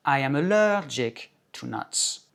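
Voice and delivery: slowly, ALLERGIC punched on LER; second-syllable stress. Shown as a demonstration. Teacher models the allergy sentence, second-syllable stress demo. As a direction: lift and stretch the LER in ALLERGIC. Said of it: "trois ou quatre tons plus haut".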